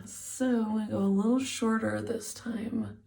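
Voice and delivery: ordinary talk, nothing marked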